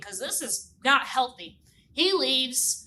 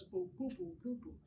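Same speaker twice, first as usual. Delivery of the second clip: soft low boo boo; bassoon imitation; 3 semitones lower, 17 dB quieter than usual. Shown as a demonstration. Teacher showing kids how a bassoon sounds, soft low boos. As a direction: hum the bassoon's low notes softly. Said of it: "You play it like this".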